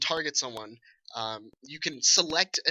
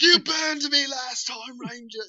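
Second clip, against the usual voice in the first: much higher, slow, loud cockney orc; a vengeful cockney orc, hammed up; cockney orc back for revenge, slow and high; cockney orc grudge; 10 semitones higher, 6 dB louder, slower than usual. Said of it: "they all have that cockney ass, like, accent".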